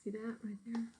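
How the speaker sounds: hushed pointing aside; whisper. Real, not acted.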